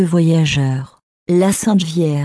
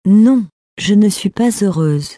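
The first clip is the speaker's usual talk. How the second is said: ordinary delivery, nothing marked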